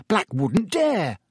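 slow, defiant and spirited. A narrator's reading. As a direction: brave boast to reassure. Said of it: "said Ron spiritedly".

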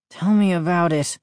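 ordinary delivery, nothing marked

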